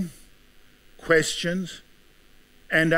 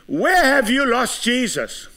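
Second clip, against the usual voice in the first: preached question; 9 semitones higher than usual. Acted. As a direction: throw the question at the crowd. Same question, now preached.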